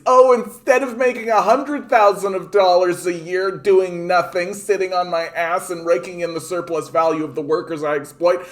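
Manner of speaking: high, loud mock whine; hammed-up self-pitying owner; whiny rich boss. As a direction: sneer at a boss crying over profits. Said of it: "their big hardship is".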